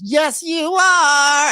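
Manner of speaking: high, loud, very slow taunt; mock Portia retort. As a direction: stretch it out like a catty comeback.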